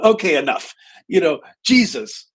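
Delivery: loud, high fed-up cutoff; exasperated outburst. Retold or imitated. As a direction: put a stop to the nitpicking, loudly. Retold outburst at a nitpicking client.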